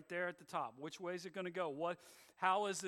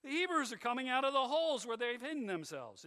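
louder, fast sarcastic jeer; 6 semitones higher, 5 dB louder than usual. Shown as a demonstration. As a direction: sneer it out mockingly. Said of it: "you have to read it sarcastically like that".

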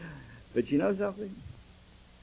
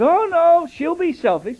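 mother's voice; 12 semitones higher, 9 dB louder than usual. Retold or imitated. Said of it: "And she says".

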